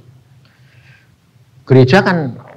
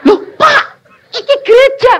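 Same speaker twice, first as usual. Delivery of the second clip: alarmed pastor; 6 dB louder than usual. Retold or imitated. Alarmed pastor questions the preacher.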